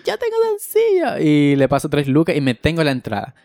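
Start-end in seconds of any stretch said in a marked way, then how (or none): 0.0-1.2 very high, pleased announcement; triumphant reply. Retold or imitated. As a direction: proudly pipe up that you have change. Triumphant answer to the seller.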